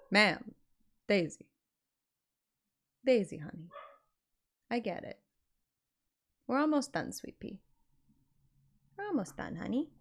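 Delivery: ordinary talk, nothing marked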